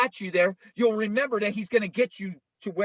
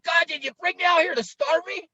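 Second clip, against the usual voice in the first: complaining Israelites; 11 semitones higher than usual. Retold or imitated.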